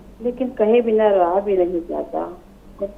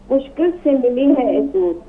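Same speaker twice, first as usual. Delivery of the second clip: poem recitation. Acted. Recites a ghazal.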